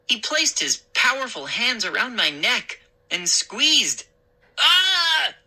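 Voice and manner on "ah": loud choked cry; gagging gasp. A narrator's reading. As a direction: gag as he squeezes. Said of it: "I gagged".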